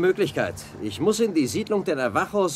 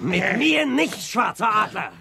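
loud, slow, high defiant cry; defiant shout; 7 semitones higher, 4 dB louder, slower than usual. Acted. Jan defying the chief who attacks him, shouting. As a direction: throw it back at him, refusing to yield.

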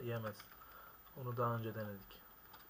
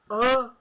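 short scream, much higher and very loud; shocked scream; 12 semitones higher, 19 dB louder than usual. Real, not acted. Gamer's bike crashing, a loud high scream. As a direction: cry out at the crash.

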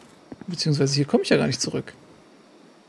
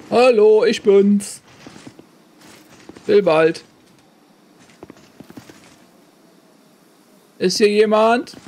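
calling out; 4 semitones higher, 3 dB louder, slower than usual. Pretend. Calling out into empty room.

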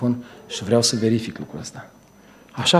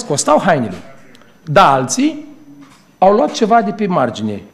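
ordinary talk, nothing marked

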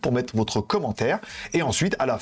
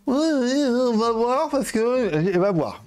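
high, drawn-out mocking ouais ouais; mock dismissive client; 8 semitones higher, slower than usual. Mocking the client who dodges his price, in a high put-on voice. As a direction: parrot her brush-off in a put-on high voice.

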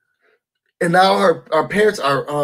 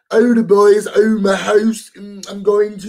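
[name]; 3 semitones higher, 3 dB louder than usual. Retold or imitated. Showing viewers how a reality star talks, exaggerated impression. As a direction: imitate her affected way of talking, slowing the words. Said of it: "[name] talk".

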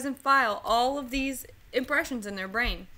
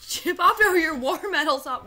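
mock yell; 5 semitones higher, 7 dB louder than usual. Quoting movie line, shouting.

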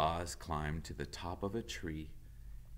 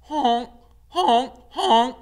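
loud high honking; loud excited goose honks; 18 dB louder, slower than usual. Acted. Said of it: "The geese were honking with excitement".

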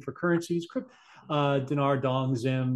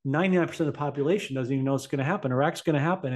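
ordinary talk

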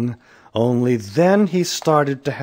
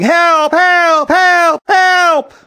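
high, loud repeated cries; yelling; 9 dB louder, much slower than usual. A narrator's reading. Man running in circles for help, yelling. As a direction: holler for help over and over.

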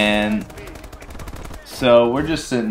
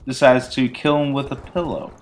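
usual speaking voice

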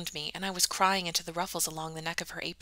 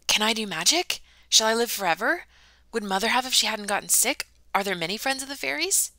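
high, louder tumbling questions; eager questions; 4 semitones higher, 4 dB louder than usual. Acted. Ella peppering Mandy with questions, eager and loud. Ella's excited questions about fairy blood.

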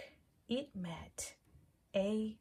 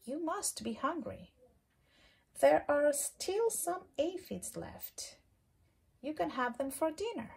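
friendly ladybug voice; 6 semitones higher than usual. Acted. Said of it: "said the friendly ladybug".

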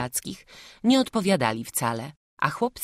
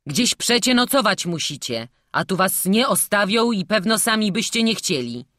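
higher, louder guard reasoning; explaining; 5 semitones higher, 5 dB louder than usual. A narrator's reading. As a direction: explain it firmly to the boy. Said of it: "tłumaczył mu strażnik". (tłumaczenie z naciskiem)

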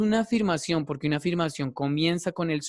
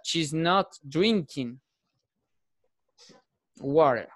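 slow demo; much slower than usual. Shown as a demonstration. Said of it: "la vamos a pasar".

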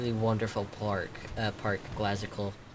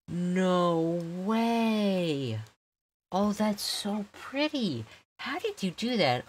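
high and bright, slow on pretty; thrilled disbelief; 7 semitones higher, much slower than usual. Real, not acted. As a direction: gush over something amazing. Awestruck reacting to a build.

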